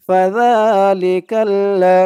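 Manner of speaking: "ফাযালিকাল লা" slow and loud, "যা" stretched; syllable-joining demo. Shown as a demonstration. Teacher joining spelled letters, slow chanted "ফাযালিকাল লা". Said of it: "লাম জবর লা".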